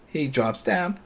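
ordinary delivery

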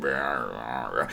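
low, soft repeated growls; three low mock growls; zombie growl. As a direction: growl like a distant walker. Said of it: "tracking down a growling noise".

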